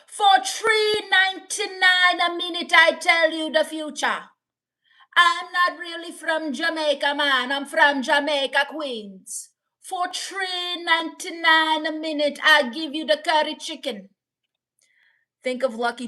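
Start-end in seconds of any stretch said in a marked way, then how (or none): 0.1-4.2 higher, mock Jamaican accent; hammed-up Jamaican psychic sales patter; Miss Cleo. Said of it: "if I impersonate Miss Cleo". 5.2-9.5 put-on Jamaican accent; hammed-up Jamaican psychic; lilting mock Jamaican, dropping at the end; Miss Cleo. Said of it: "if I impersonate Miss Cleo". 9.8-14.1 higher, mock Jamaican accent; hammed-up Jamaican psychic sales patter; Miss Cleo. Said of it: "if I impersonate Miss Cleo".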